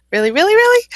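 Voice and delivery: high, loud rising "really" three times; playful disbelief. Real, not acted. Host teases how the viewer names sound like a rap, loud and high. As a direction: squeal it in amused disbelief.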